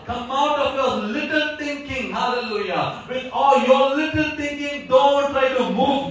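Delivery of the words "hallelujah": normal talking voice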